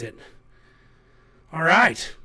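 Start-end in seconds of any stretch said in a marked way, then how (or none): none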